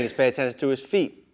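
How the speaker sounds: usual speaking voice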